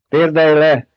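loud command. A narrator's reading. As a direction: command him aloud. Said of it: "fennhangon azt mondta". (Paul fennhangon parancsol Stilgárnak)

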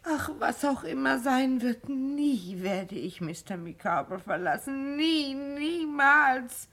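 tearful vow never to leave him; crying. Acted. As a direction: sob through the vow. Wife weeping over husband.

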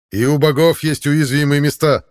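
loud, high rallying cry; encouraging shout. A narrator's reading. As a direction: cheer the comrades on loudly. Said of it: "обнадёживающе подбодрил соратников Хаук".

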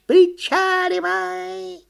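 very high, long-drawn command; piercing cartoon monkey call; yelling monkey. A narrator's reading. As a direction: squeal the order, stretching each vowel. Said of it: "закричала «Почешись»".

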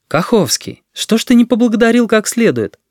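high-pitched reproachful call; teacher's reproach. A narrator's reading. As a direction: call the pupil back with a pointed question. Teacher calls out a scolding.

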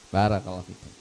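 ordinary delivery

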